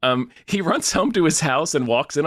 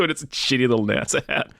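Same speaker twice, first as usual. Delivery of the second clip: usual speaking voice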